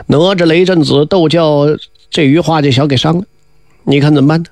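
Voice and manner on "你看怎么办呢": plain reading voice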